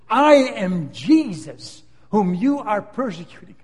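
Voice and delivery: slow, loud, weighty declaration; each word laid down slowly like a verdict; voice of Jesus. Acted. Jesus answering Saul from heaven, slow and commanding.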